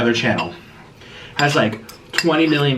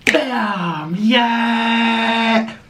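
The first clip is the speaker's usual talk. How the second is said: high cheering yells; excited shout; 9 semitones higher, 4 dB louder, much slower than usual. Real, not acted. Starting her challenge for viewers, cheering loudly. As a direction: whoop it up to kick things off. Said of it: "I always do a bam".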